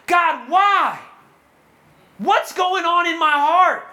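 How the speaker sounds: high, loud cry to heaven; anguished plea. Acted. Believer asks God about the anxiety inside, anguished plea. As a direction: cry out in desperate questioning.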